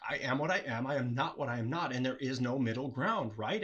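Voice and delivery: normal talking voice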